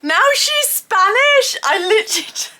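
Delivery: loud, high disbelief with stretched-out words; incredulous outburst. Real, not acted. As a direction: exclaim it in utter bafflement, slow and loud. Baffled outburst reacting to the show.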